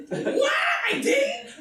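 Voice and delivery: high, shouted, laughing disbelief; a boy learning he's not to blame; overjoyed kid. Retold or imitated. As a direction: squeal it like a kid who can't believe the good news.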